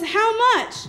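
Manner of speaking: a short yell from the back; calling out. Retold or imitated. Stranger shouts question.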